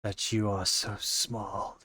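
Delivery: a lightly voiced ancient beast; a lightly voiced monster looking down at tiny adventurers. GM voices giant snake monster.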